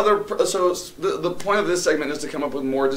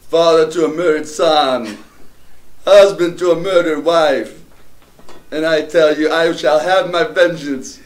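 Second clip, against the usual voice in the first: put-on a shaky Jamaican accent, slow and louder, breaking into laughter; bad Jamaican accent; 3 semitones higher, 6 dB louder, slower than usual. Friends dare him to do Gladiator, badly faked Jamaican accent. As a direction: push a Jamaican accent through the Gladiator speech, giggling. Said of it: "That's all he's got is Jamaican".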